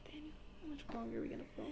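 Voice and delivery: fast, voiceless whisper to the co-host. Real, not acted. Co-host whispering to Daniel, asking how much longer, fast whisper. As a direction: rush the question out in a whisper.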